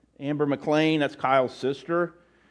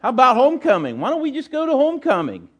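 put-on girl asking; 8 semitones higher, 4 dB louder than usual. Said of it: "she walked up to him and he goes".

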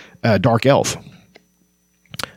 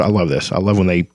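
ordinary talk, nothing marked

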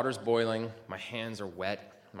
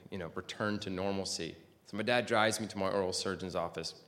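ordinary delivery

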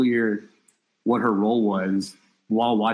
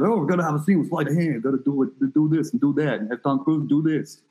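imitating De Palma; 5 semitones higher than usual. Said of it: "that's not how he talks".

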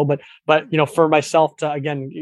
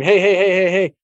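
mock salesman, higher and a bit louder; pushy ad voice; 5 semitones higher, 4 dB louder than usual. Mocking pushy advertisers, hammed-up hey. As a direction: jam it in their face. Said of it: "jamming an ad in your face saying".

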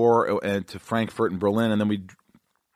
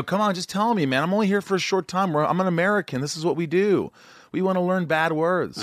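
pleading self; 7 semitones higher than usual. Retold or imitated. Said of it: "And I go".

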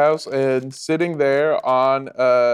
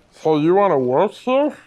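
higher put-on boss voice, winded; heavy-breathing editor; 4 semitones higher than usual. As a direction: speak through laboured breath. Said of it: "breathes heavily and goes".

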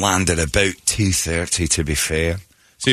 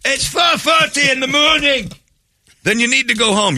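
rising, much higher, exasperated guard voice; a fed-up guard rousing drunks, getting shriller; security guard; 13 semitones higher than usual. Retold or imitated. Security guard waking the sleeping Scots, exasperated and rising high. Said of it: "security came and woke him again".

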